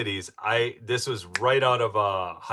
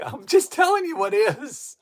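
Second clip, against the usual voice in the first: laughing; 13 semitones higher, 10 dB louder, slower than usual. Real, not acted. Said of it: "still laughing after the impression".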